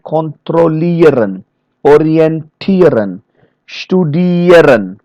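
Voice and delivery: "kontrollieren, orientieren, studieren" slowly, leaning on IE; stress on IEREN. Shown as a demonstration. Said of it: "this ieren is always going to be stressed".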